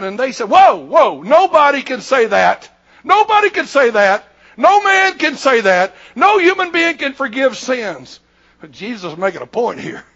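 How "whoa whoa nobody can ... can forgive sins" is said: loud, higher protest; outraged objection. Retold or imitated. Scribes protest to Jesus that only God forgives, indignantly. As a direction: cry out in raised-voice disbelief.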